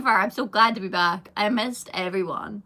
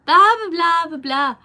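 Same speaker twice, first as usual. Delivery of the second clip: high, loud, slow dismissive parent voice, imitating them; mocking the parents; 7 semitones higher, 7 dB louder, slower than usual. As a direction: mimic mum brushing it off, hammed-up. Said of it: "and they're like".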